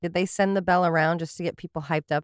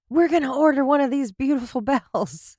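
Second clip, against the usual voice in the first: higher, gushing imitation of eager buyers; mock excitement; 5 semitones higher, 3 dB louder than usual. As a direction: play the thrilled customer, with a laugh. Acts out the excited city.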